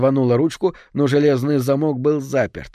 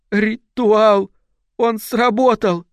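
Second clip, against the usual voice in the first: frightened wail; 10 semitones higher, 4 dB louder, much slower than usual. Acted. Fiona moaning in terror.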